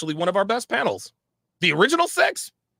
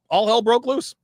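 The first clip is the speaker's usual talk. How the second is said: higher and louder "All hell broke loose", "broke" rising; louder dramatic repeat; 5 semitones higher, 4 dB louder than usual. Real, not acted. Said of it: "repeated for effect".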